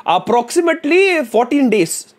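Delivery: put-on slow, high Indian accent. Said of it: "did you hear his Indian accent".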